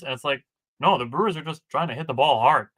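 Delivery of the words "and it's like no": usual speaking voice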